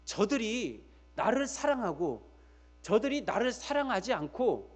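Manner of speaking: ordinary delivery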